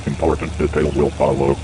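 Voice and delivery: normal talking voice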